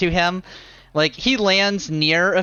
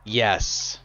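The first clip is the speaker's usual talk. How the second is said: lower, terse gargoyle reply; one heavy stone word; low gargoyle yes; 6 semitones lower, 4 dB quieter than usual. Acted. Gargoyle answers.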